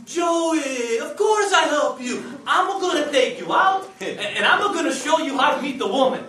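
put-on big loud Italian uncle accent; thick Italian accent. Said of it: "in his thick Italian accent".

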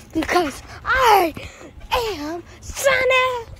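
rising high, slowing on Sonic; heroic declaration. Pretend. Sonic plushie rallying himself to keep fighting, heroic high cry.

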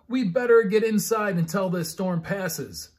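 loud, high call to a friend; Ava yelling. A narrator's reading. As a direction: call him in out of the storm. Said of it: "Ava yelled".

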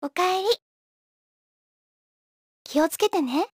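warm homely greeting. Acted. Welcoming someone home and seeing them off, tender. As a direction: say it gently and lightly.

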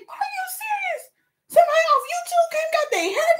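a mother amazed that a stranger came for her hair; dramatic mama. Retold or imitated. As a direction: play her mother gasping in disbelief. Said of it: "my mama dramatic".

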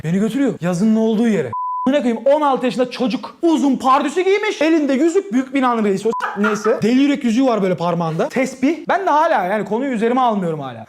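usual speaking voice

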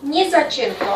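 normal talking voice

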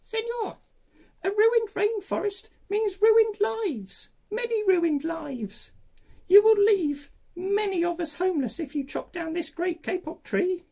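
squeaky tree frog. Acted. Little tree frog piping into the man's ear, squeaky and high. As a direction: pipe it tiny and squeaky. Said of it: "In a squeaky voice, he piped".

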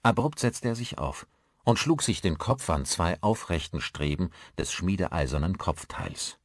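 usual speaking voice

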